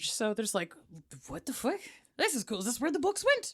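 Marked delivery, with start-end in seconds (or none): 1.3-3.5 mock amazed Viking. Viking discovers books.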